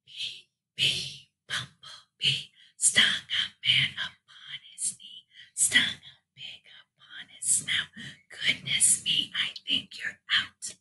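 slow rhythmic whisper; hushed, voiceless chant. Shown as a demonstration. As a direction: whisper the rhyme on a steady beat. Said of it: "do it in our whisper voice".

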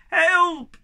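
high, loud cry at the top of his voice; desperate yell; yell for help. A narrator's reading. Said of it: "yelled at the top of his voice".

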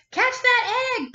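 high, loud panicked yell; Chicken yelling. Acted. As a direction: yell for help as the egg rolls away. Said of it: "poor Chicken yelled".

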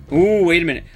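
ordinary talk, nothing marked

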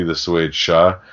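normal talking voice

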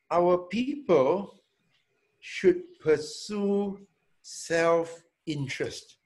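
very slow, each word weighed; slow emphatic statement. Real, not acted. Manager to staff, slow and firm on the key point. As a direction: lay the point down slowly and firmly.

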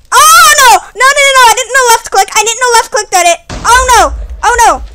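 high, loud whiny dismay; dismayed wail. Real, not acted. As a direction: wail at own mistake. Wrong click blows things up.

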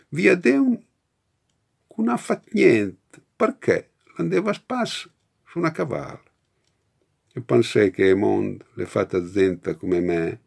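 a poem recited in Romagnolo dialect. Shown as a demonstration. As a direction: read it in thick Romagnolo dialect. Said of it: "i nomi delle strade di Nino Pedretti".

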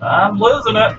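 high, loud, panicked shout. Real, not acted. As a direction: yell it in panic. Panicking near end of night.

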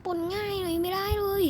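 lightly voiced sulky child; high whiny voice. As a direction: whine it like a sulky kid. Repeat in put-on voice.

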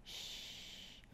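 soft breathy shush. Shown as a demonstration. Teacher shushing kids in a song, whispered. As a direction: hush them like a sleeping baby.

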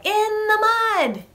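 put-on very high, loud chant for children; gleeful squeal. Shown as a demonstration. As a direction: squeal it out on one high note. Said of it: "So we're gonna say".

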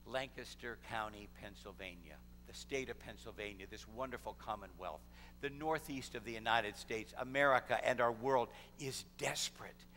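low, hushed sermon appeal; solemn preaching. Real, not acted. Preacher tells the congregation the world is desperate, solemn appeal.

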